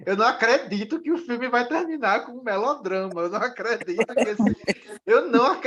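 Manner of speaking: animated replay of being appalled; mock outrage. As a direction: protest in disbelief, reenacting your own outrage.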